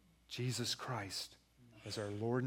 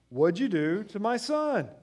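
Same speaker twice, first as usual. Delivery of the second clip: angry dad demand; 9 semitones higher, 10 dB louder, slower than usual. Retold or imitated. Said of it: "I jumped to a conclusion".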